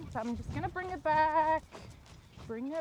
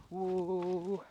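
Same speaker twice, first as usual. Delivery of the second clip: whoa; 6 semitones lower than usual. Real, not acted. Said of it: "that wasn't a whoop".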